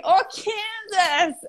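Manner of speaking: high, drawn-out American "Kansas"; attempted American accent. Finally making the guy understand, hammed-up American accent. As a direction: exaggerate an American accent, slowly, on a laugh. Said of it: "I could do for an American accent".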